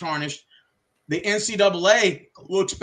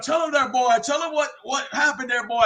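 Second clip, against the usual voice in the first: mock Saban order; 6 semitones higher than usual. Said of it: "he's gonna be like".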